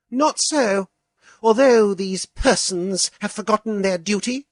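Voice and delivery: high, scornful, persons stressed; sarcastic scorn. A narrator's reading. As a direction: sneer at persons. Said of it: "particularly sarcastic emphasis on the word persons".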